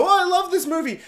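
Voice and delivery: high-pitched gush of praise; mock gushing. Reviewer imagining too much praise, gushing. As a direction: gush like a smitten fan. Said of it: "sing this film too much praise".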